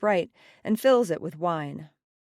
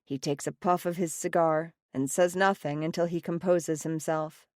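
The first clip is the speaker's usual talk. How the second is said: ordinary delivery, nothing marked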